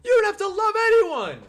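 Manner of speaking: high, loud exasperated outburst; loud rant. Real, not acted. Streamer ranting at chat about Shedeur hype, loud exasperated shout. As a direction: shout the point home, fed up.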